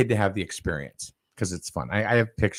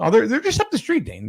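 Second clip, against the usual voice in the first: high-pitched, louder placating voice; mock reassurance; 14 semitones higher, 4 dB louder than usual. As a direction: brush off a child's worry in a raised pitch. Placating impatient child in story.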